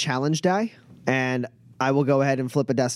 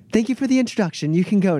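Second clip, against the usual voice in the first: higher, quick and airily dismissive; dismissive noblewoman; 8 semitones higher, faster than usual. Acted. Noblewoman sending Shamash away with quick, airy dismissal. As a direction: brush him off sweetly and quickly.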